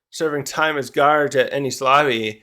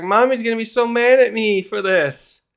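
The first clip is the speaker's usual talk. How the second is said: high-pitched mock panic; freaking out; 7 semitones higher than usual. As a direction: act out a kid dreading mommy. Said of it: "he's just freaking out too".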